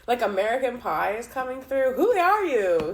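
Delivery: high loud laugh into excited question. Real, not acted. Reader laughing loudly at the cards, calling to the spirit. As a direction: burst out laughing and ask.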